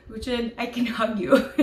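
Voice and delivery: high, fast and giggling; giddy offer. Pretend. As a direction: gush at him through laughter. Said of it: "I'm just gonna go".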